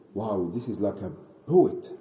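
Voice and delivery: low, hushed musing; quiet wonder. Retold or imitated. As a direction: murmur it to yourself, impressed. Umar secretly listening, amazed, to recitation.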